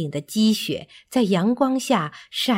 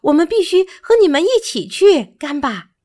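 high, fast, eager monkey voice; high-pitched, laughing-toned eagerness without laughter; cheerful monkey; 9 semitones higher, 6 dB louder, faster than usual. A narrator's reading. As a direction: pipe up excitedly, rushing the words. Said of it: "他抓住自己的尾巴笑道".

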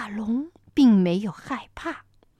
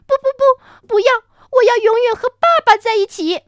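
put-on pleading child; 10 semitones higher, 11 dB louder than usual. Baby refuses to part.

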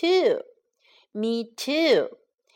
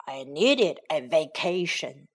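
dinosaur voice; 7 semitones lower than usual. Acted. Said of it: "said the dinosaur".

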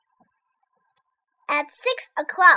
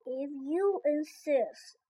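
praying mantis; 8 dB quieter, much slower than usual. A narrator's reading. Mantis replies.

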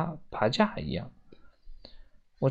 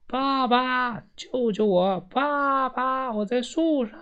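high, drawn-out cries for help at held-back volume; desperate calling; 9 semitones higher, slower than usual. A narrator's reading. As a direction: call to dad with all your might, pitched way up. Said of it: "我用尽力气喊道". (拼命高声呼救)

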